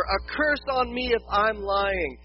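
slow, emphatic, hammed-up oath; sworn denial. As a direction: hit every word of the denial, loud and drawn out. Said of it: "Peter swore".

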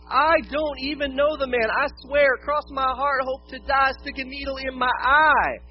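high, loud acted protest; sworn denial. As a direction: swear it emphatically, hammed-up. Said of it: "this time with an oath".

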